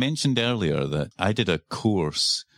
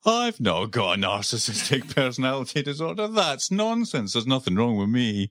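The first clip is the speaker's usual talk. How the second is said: huffy denial, pitch jumping up; indignant narcissist; 4 semitones higher than usual. Retold or imitated. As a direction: scoff it away like an offended narcissist.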